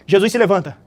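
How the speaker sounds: normal talking voice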